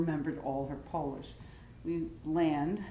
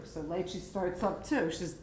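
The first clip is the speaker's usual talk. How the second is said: ordinary talk, nothing marked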